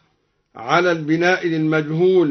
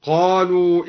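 ordinary talk

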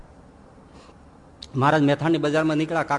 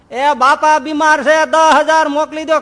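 much higher, loud slow yell; yelling over a bad line; shouting into phone; 11 semitones higher, 10 dB louder, slower than usual. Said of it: "જોરથી બોલ".

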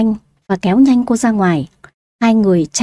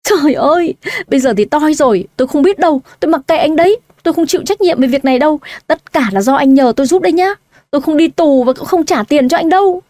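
higher, tearful panicked wail; a pitched-up wail of fear and tears; 6 semitones higher than usual. Acted. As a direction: cry it out, blaming him in despair. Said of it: "khóc rống lên".

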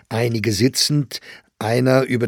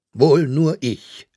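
slow, raised, mocking tone; jeering; 5 semitones higher, 6 dB louder, slower than usual. A narrator's reading. Bolling taunts the actress who denies involvement, jeering. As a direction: sneer it with drawn-out scorn. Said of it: "höhnte Bolling".

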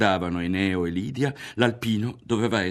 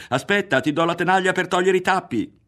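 higher, louder hurried call; 11 semitones higher, 7 dB louder than usual. A narrator's reading. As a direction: catch him before he goes. Berto calling after Ario. (richiamo alto e affrettato)

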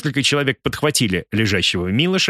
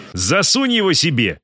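shout; 6 semitones higher than usual. Acted. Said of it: "закричал Артур".

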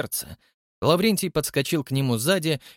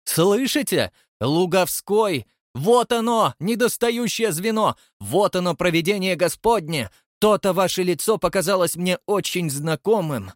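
put-on higher, louder elated old man; triumphant; 6 semitones higher, 3 dB louder than usual. A narrator's reading. Chairman exulting to the hall, elated. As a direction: crow over the discovery.

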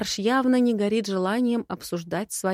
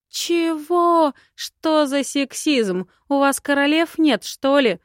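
high, loud, drawn-out indignation; offended, incredulous outburst; indignant; 7 semitones higher, 7 dB louder than usual. Acted. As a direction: protest in a pitched-up, outraged voice. Heroine objects to lord's sexism.

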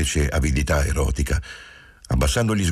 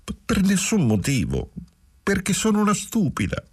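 high, broken by sobs, stumbling on the first word; tearful, sobbing. Acted. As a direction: speak through tears, almost childish. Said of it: "Giulia singhiozzò ancora un poco e poi rispose". (con voce rotta dal pianto, acuta)

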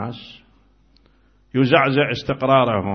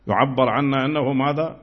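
ordinary talk

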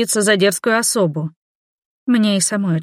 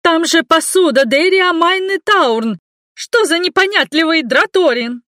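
shrill scolding woman; 10 semitones higher, 5 dB louder than usual. A narrator's reading. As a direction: scold the guard like a fussy servant. Indignant servant scolds guard.

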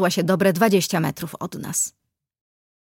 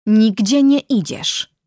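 slow, louder, firm order; forceful, deliberate command; firm refusal; 3 semitones higher, 5 dB louder, slower than usual. A narrator's reading. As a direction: block them with measured authority. Man blocks the struggling girl.

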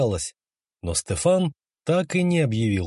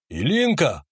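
calling out. A narrator's reading. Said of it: "ещё громче позвал он".

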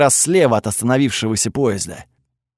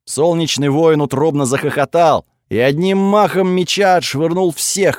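usual speaking voice

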